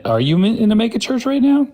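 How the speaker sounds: teasing high-pitched jab; mocking high question. Real, not acted. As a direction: pitch leaps way up, fast.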